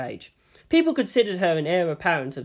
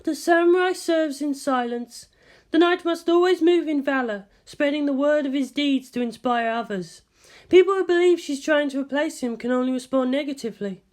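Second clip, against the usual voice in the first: higher, composed scientist voice; Ritsuko's voice; 10 semitones higher than usual. A narrator's reading. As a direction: speak as the measured senior scientist. Ritsuko explaining.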